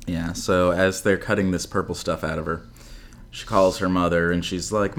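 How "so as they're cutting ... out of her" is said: ordinary delivery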